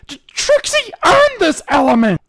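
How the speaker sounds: high, loud stammered protest; hurt, indignant. Acted. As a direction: defend herself, wounded and furious. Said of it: "half hurt and half infuriated".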